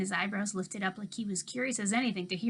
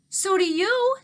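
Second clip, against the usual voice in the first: high, loud shout back; hollered back; 11 semitones higher, 10 dB louder, slower than usual. A narrator's reading. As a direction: throw it right back at her. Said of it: "Toby hollered back".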